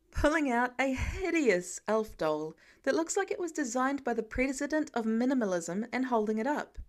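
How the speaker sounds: ordinary delivery, nothing marked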